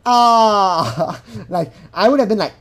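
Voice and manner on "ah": loud, high drawn-out "aah"; mock cry of disbelief. Imagining her reaction to a marriage proposal, loud mock cry. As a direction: wail a loud what-the-fuck "aah". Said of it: "you don't feel that she's disgusted".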